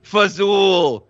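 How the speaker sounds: low, drawn-out Italian-American caricature; mock Brooklyn Italian. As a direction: chuckle and stretch each word in a Brooklyn Italian accent. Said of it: "grew up on the block in Brooklyn".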